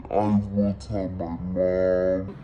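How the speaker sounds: very low, slow, dragged-out mock voice; mock deep childish threat. Telling the ex who asked for a kiss, in a hammed-up low voice. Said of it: "That's what I said to him".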